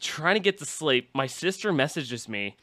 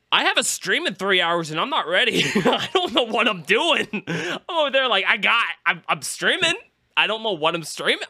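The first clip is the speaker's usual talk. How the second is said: panicky self; 8 semitones higher than usual. Retold or imitated.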